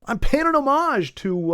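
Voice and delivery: hammed-up innocent "it's an homage"; mock excuse. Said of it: "and then just said".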